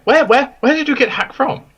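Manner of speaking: high, loud, eager questioning; excited questioning. Acted. Character asks where the creature came from, excited. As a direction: burst in, desperate to know.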